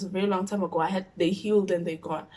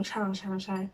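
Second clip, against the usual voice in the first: ordinary talk, nothing marked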